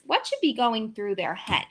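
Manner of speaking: usual speaking voice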